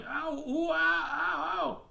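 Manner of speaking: high-pitched drawn-out ows; yelling in pain. Acted. Something yelling under a pile of flies, high pained wailing. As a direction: wail "ow, ooh" way up high. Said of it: "something was yelling".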